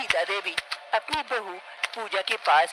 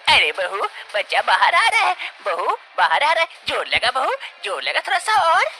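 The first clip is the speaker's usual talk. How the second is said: higher, louder, rushing at the end; thrilled, push her on; excited urging; 8 semitones higher, 4 dB louder than usual. Acted. Mother-in-law seeing baby come out, excited urging.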